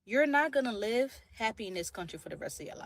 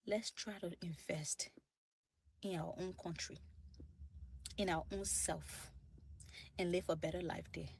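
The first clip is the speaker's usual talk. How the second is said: Liberian accent; 5 semitones lower, 12 dB quieter than usual. Shown as a demonstration. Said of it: "I'm gonna put in my Liberian accent".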